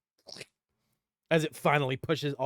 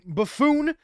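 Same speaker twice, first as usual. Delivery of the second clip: mild angry king; 8 semitones higher, 7 dB louder than usual. Acted. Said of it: "King Dongle's like".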